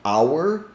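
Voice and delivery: "Our" said like "hour", two syllables. Shown as a demonstration. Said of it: "two ways to pronounce this word".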